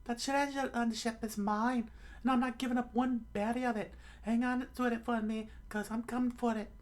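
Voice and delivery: very high falsetto claim; high put-on character voice; greedy treasure owner. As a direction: stake the claim in a squeaky cartoon voice.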